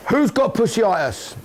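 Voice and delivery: high, drawn-out call across the room; calling out to group. Real, not acted. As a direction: holler the question to the squad. Calling out to the squad.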